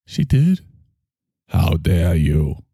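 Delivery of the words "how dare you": low, slow disdainful 'How dare you?'; Greta's frozen-scowl voice, low and slow; Greta Thimble. Acted. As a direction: drop low and drag it out with disdain. Greta repeats her line.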